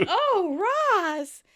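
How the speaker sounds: high, loud mock swoon; hammed-up lover's cry; mock passion. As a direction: answer with exaggerated lust. Acted lustful reply to Ross.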